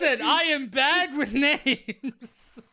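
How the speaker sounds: slow, laughing excuse. Real, not acted.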